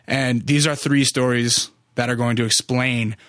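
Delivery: normal talking voice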